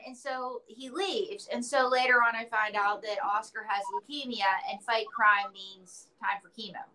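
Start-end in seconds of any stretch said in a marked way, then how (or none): none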